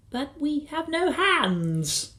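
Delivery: put-on high, slow little boy; high-pitched, drawn-out objection; Philip. A narrator's reading. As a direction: protest in a plaintive child's voice. Boy protesting.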